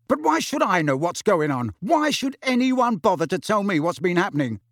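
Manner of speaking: high, heated demand; angry. A narrator's reading. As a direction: demand answers, burning with resentment. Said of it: "his fury".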